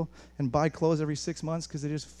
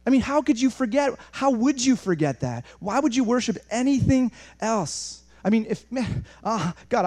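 raised, fervent preaching voice; impassioned disbelief; 5 semitones higher, 4 dB louder than usual. Acted. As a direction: cry it out as heartfelt conviction. Preacher exclaims, then answers God passionately.

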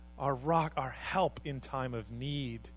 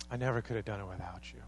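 heartfelt thanks; 6 semitones lower, 6 dB quieter, faster than usual. Shown as a demonstration. Repeats key phrase softly.

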